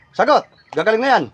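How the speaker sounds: loud, insistent interrogation; forceful demand. Real, not acted. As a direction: press hard for the answer. Presses spirit for an answer.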